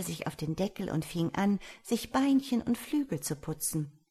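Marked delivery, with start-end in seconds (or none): none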